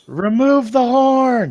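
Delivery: high, loud, stretched-out shout; yell. Acted. Player as Ymir demanding the horn, high drawn-out yell. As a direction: holler it slowly like a battle order. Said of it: "Ymir's gonna yell".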